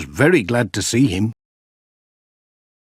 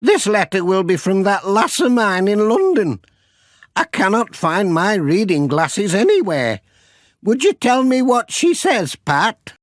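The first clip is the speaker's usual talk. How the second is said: put-on high, fond old Northern granny; 10 semitones higher than usual. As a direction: pitch it up like a kindly old grandmother. Granny Dryden speaks.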